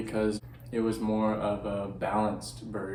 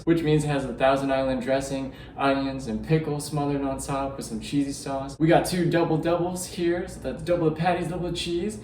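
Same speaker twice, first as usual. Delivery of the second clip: higher, upbeat presenter voice; food show host; 5 semitones higher, 4 dB louder than usual. Acted. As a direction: show off each dish like a host.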